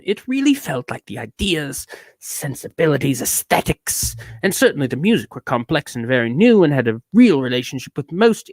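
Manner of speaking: affected, swooping British-tinged reading; put-on character voice with exaggerated emphases; mannered reviewer character. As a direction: pontificate like a self-important critic. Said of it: "Who's this character I'm doing?".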